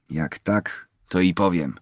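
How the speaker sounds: plain reading voice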